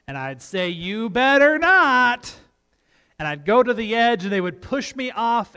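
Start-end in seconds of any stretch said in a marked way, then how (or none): 0.7-2.3 slow, loud, rising playful threat from Dad; mock warning. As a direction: draw out a teasing warning to the kids. Acts dad's playful warning.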